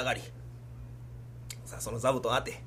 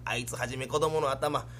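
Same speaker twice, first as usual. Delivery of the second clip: usual speaking voice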